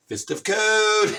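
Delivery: slow, loud announced "Fist of Cohn"; dramatic call. Acted. Roy Cohn summoning his guards, slow and loud. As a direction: proclaim it like a villain's summons. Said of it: "and he goes".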